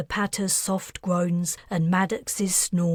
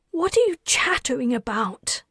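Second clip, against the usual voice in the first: ordinary delivery, nothing marked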